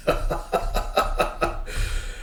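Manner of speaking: soft staccato chuckle; quiet run of breathy laughter. Real, not acted. Laughs after reading the last line.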